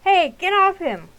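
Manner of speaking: loud, high, slow yells; shouting. Acted. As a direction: kids screaming across the water. Kids shouting during the fight.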